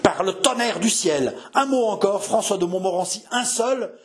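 high-pitched thundering threat; furious outburst. Acted. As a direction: explode at a defiant son. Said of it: "et il éclata". (éclat de colère menaçant)

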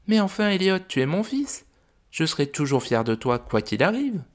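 high, gentle mother dragon voice; a fond, worried dragon mum. Acted. Mother dragon telling the visitors about her son, high gentle voice.

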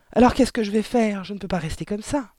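mild panic. Acted. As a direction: plead with your wife for a solution. Father panics about his hair. (léger affolement, voix haussée)

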